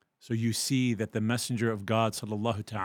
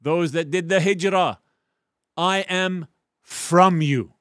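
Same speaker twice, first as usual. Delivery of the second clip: slow, louder, higher earnest pronouncement; drawn-out heartfelt proclamation; solemn declaration; 7 semitones higher, 8 dB louder, slower than usual. Acted. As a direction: declare it slowly to the migrants. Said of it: "He says".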